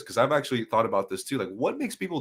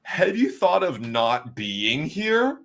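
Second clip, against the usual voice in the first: higher, louder, slow mock-preachy advice; 8 semitones higher, 6 dB louder, slower than usual. Pierre parodying advice to the bullied Albanian waiter, higher and slow. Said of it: "I go to the Albanian waiter".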